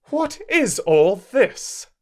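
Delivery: high, slow, commanding mistress; peremptory demand. Acted. Mrs Reed demands an explanation from the servants, high and peremptory. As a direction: cut in with stern authority. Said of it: "demanded another voice peremptorily".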